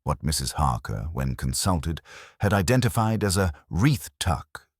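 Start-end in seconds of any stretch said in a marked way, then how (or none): none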